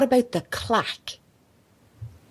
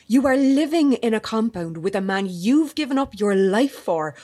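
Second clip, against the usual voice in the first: ordinary talk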